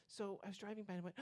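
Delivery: ordinary talk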